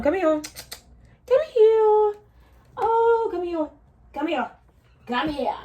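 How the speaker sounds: high sing-song pet voice; high cooing call to a pet; calling the cat. Real, not acted.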